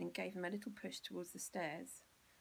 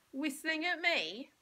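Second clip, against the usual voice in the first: higher and louder, slow, menacing; angry challenge; 8 semitones higher, 9 dB louder, slower than usual. Acted. Bill rounds on Mean Malcolm in fury, slow and loud. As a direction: spit it out at the bully.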